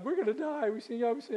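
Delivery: hammed-up terrified father, voice jumping up high; freaking out. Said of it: "he's freaking out".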